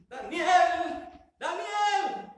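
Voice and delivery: very high, loud yell; frantic high-pitched holler; shouting while running. Acted. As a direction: scream the name like a running child. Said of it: "iba gritando. Así como los niños cuando van corriendo".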